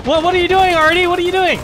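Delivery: exasperated yell. Real, not acted. Yelling at teammate Artie.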